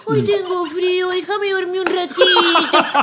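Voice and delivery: high, slow, mock-whiny voice; mocking whine. Argentine spouse mocking the other in airport fight, mocking whine. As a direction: mimic a spouse whining to stay asleep. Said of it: "Le hacía así, le hacía así".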